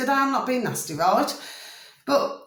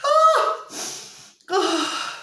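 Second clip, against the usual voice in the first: helpless "oh" squealed at the end of a giggling fit; spent, high-pitched moan after laughing; high laughing wail, then a falling sigh; 10 semitones higher than usual. Real, not acted. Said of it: "I'm crying".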